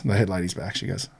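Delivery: plain reading voice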